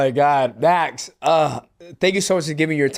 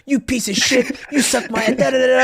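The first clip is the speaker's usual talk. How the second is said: mock trash talk; 9 semitones higher than usual. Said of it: "it's like".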